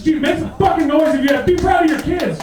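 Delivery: high, loud call to the crowd; hyping the crowd. Real, not acted.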